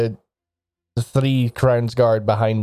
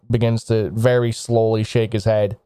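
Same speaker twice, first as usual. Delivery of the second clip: normal talking voice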